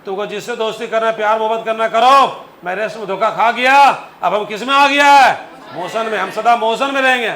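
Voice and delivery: hammed-up shout at the lazy body part; mock yelling. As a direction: bellow it like a cheeky bus body. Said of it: "तू चिल्ला चल बोला".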